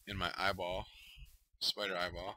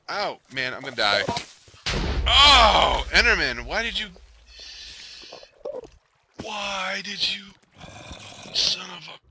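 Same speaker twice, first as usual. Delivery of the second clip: furious yelling; 10 semitones higher, 14 dB louder, slower than usual. Real, not acted. Enderman hits him, loses diamonds.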